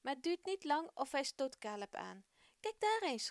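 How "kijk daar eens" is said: high, eager call to a friend; enthusiastic call. Acted. Gerson calls to Caleb, spotting something in the desert, excited. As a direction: point it out, thrilled. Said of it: "roept hij enthousiast".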